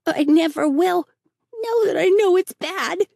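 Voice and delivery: high, loud tearful promise; earnest between sobs. Acted. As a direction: vow it through sobs. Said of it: "asseverated Davy between sobs".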